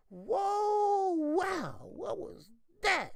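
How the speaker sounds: high, slow, stunned cry of a rocked fighter; dazed surprise. Retold or imitated. Sean Porter stunned by a punch, crying out dazed. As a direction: reel like you just got hit hard. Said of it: "Sean Porter was like".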